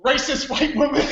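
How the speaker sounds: ordinary talk, nothing marked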